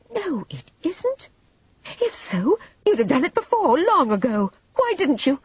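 high, agitated challenge; accusing, high-pitched demand; heated contradiction. A narrator's reading. Vivian angrily contradicts in quarrel.

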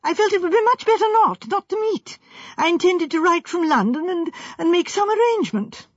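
high-pitched, stammering protest; worked-up, flustered self-defence; high and excited. A narrator's reading. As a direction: gabble excuses in agitation. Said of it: "Sanford's voice rose high and excited".

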